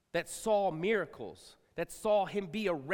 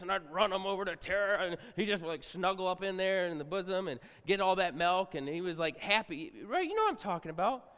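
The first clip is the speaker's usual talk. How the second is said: usual speaking voice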